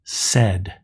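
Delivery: "Sed" said slowly and clearly as one closed syllable, s-e-d; Sed pronounced carefully with a short e and a crisp final d; Hebrew syllable demo. Shown as a demonstration. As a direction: model "Sed" for the class. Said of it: "Two examples".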